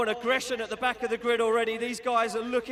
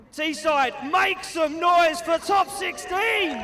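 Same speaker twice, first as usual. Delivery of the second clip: higher, slow stretched yell; crowd hype shout; 4 semitones higher, 4 dB louder, much slower than usual. Real, not acted. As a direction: rouse the whole stadium.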